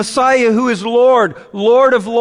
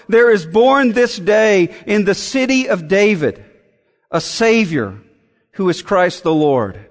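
usual speaking voice

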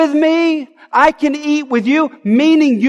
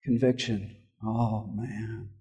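mock sulky grumble; 13 semitones lower, 16 dB quieter, slower than usual. Mocking a sulking churchgoer.

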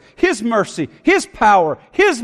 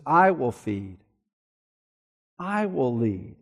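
1 soft reverent hush; 8 semitones lower, 8 dB quieter than usual. Acted. Soft closing reflection.

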